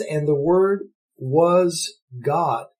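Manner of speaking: slow, weighty, every word set apart; solemn, slow scripture reading; slow emphatic reading. Shown as a demonstration. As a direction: let the verse land slowly. Verse reread slowly for emphasis.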